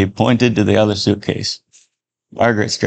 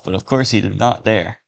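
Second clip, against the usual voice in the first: ordinary delivery, nothing marked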